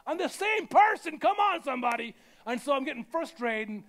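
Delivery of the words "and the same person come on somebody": exasperated outburst. Retold or imitated. Frustrated at passport machine.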